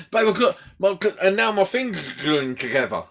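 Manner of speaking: muffled tongue-tied explanation; struggling to talk, tongue stuck; tongue stuck to palate. Retold or imitated. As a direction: explain urgently with tongue glued up. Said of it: "My tongue got stuck to my roof".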